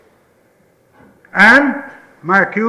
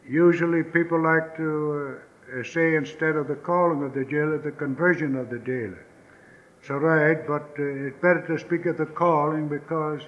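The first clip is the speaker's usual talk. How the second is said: low aside; 6 semitones lower, 10 dB quieter, faster than usual. Real, not acted. Low quick aside on wording.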